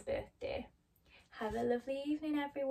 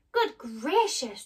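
slow, louder amazed cry; surprised exclamation; 4 semitones higher, 7 dB louder, much slower than usual. Acted. Dorothy landing home in Kansas, amazed. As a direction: marvel at landing back home. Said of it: "Dorothy cried".

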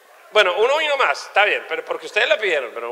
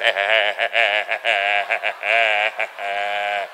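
low stammered repeating vowel; stuck stammer, low and drawn out; stuttering noise; 10 semitones lower than usual. Acted. Comic describing people who stutter, stammered noise. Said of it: "hablan en original y tres copias".

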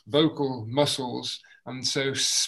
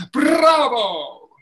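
loud, higher Bravo with arms up; triumphant shout bravo; cheering bravo; 10 semitones higher, 10 dB louder than usual. Shown as a demonstration. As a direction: cheer it to the rafters. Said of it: "throw your arms into the air".

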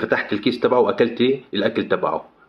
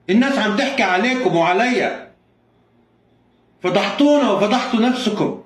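much higher shout to stop the fight; shouting enough; 11 semitones higher than usual. Acted. Owner stopping the couple's fight, high shouting. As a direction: yell at them to stop.

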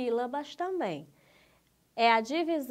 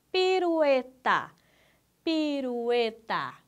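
"pirueta" slowly stretched into four beats; syllable by syllable; 2 semitones higher, 4 dB louder, slower than usual. Shown as a demonstration. Teacher counting four syllables, said syllable by syllable. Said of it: "ela tem quatro sílabas".

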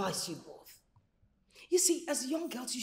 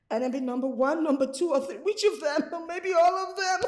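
rising lament; 4 semitones higher, 7 dB louder than usual. Acted. Mother lamenting, escalating.

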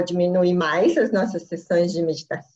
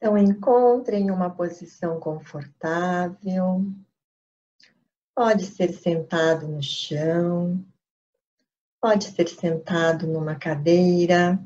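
guided meditation. Acted. Begins leading the meditation. (voz pausada de meditação guiada)

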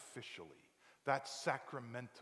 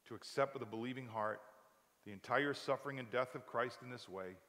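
usual speaking voice

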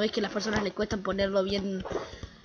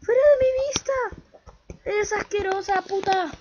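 terrified yelling; 9 semitones higher than usual. Real, not acted. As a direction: shriek at the spider in disgust. Yelling at spider. (gritos agudos y fuertes de asco y miedo)

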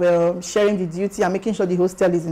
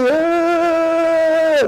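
high, stretched-out crowd cheer; imitated cheering; 8 semitones higher than usual. Hungry students shouting for the winning house, long imitated cheer. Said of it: "Hungry brothers shouting".

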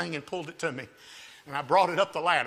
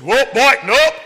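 higher, sudden loud holler; calling off a dog; 8 semitones higher, 14 dB louder, slower than usual. Acted. Man calls after his dog chasing a squirrel, acted loud holler. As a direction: holler it out in short bursts.

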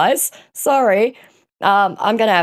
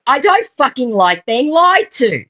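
raised, angry confrontation; angry at scammer; 7 semitones higher than usual. Real, not acted. Confronting the scammer on the call, angry and raised. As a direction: let your anger show, pushing hard.

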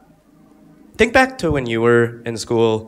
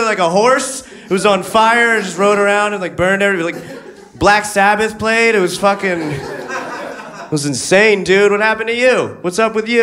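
excited afterlife crowd; 10 semitones higher than usual. Retold or imitated. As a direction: gush about what they missed. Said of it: "they're just like".

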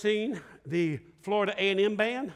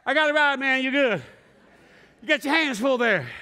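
teasing; 4 semitones higher, 4 dB louder than usual. Retold or imitated. Teasing friend holding girlfriend.

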